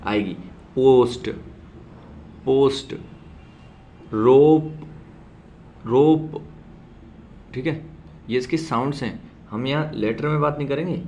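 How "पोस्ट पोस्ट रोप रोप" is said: पोस्ट and रोप called out loud, each word said twice; loud sound demo. Shown as a demonstration. Teacher showing students the P sound in words. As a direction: drill the words loudly for the class. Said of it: "sound कैसे इसकी आएगी".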